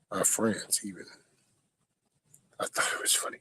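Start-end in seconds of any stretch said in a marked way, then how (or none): 2.6-3.4 breathy whispered aside. Real, not acted. Confiding to chat in a whisper. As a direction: drop to a secret whisper.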